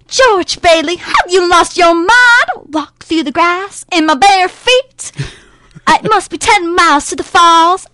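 put-on girly, snooty Southern twang, high and loud; Violet Bick. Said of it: "girly, girly, like, snooty little".

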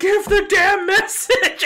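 high, loud shout. Real, not acted. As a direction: yell it at him. Players urging the captain.

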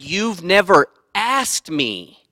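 loud, emphatic reenactment of an outburst; retold yell. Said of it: "I yelled at him".

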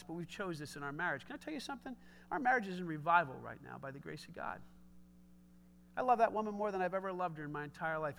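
normal talking voice

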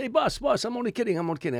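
high, loud call of a store clerk; a clerk calling after him, boss, I'm only kidding. Retold or imitated. Clerk chasing a customer about a hat, high pleading call.

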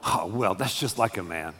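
low, soft mock disapproval; épouses blasées, imitées. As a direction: mutter it low, as resigned wives would. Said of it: "You're going".

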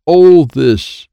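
very loud, high shout; shouted order. A narrator's reading. Child insisting Wyatt take a card, shouted order.

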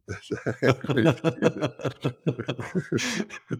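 long, high burst of laughter; real laughter. Real, not acted. Host hears the father's folding-ruler trick, bursts out laughing. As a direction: laugh helplessly in a long run.

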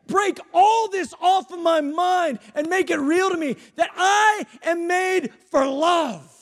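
high, loud, pleading prayer; fervent prayer. Acted. Preacher crying out to God in fervent prayer. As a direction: cry the prayer out, climbing to a shout.

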